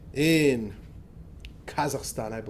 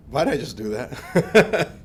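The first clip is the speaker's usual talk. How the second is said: laughing self-reproach; 7 dB louder, slower than usual. Retold or imitated. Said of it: "I was like".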